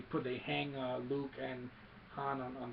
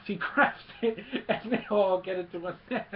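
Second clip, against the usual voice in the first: higher, louder words tumbling out through laughter; real laughing; 6 semitones higher, 8 dB louder than usual. Real, not acted. Retelling the heroes caught in a net, laughing as he talks. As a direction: tell it while cracking up.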